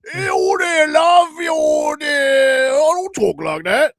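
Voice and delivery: hammed-up British accent, loud and high; mock British accent. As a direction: shout it in a caricatured high British squawk. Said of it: "That's how they talked".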